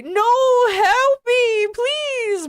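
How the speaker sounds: high, loud hammed-up cry for help; terrified scream. A narrator's reading. Reading fanfic aloud, voicing Brittany's hammed-up terrified scream. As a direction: shriek it like a melodramatic victim. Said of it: "Brittany screamed terrifiedly".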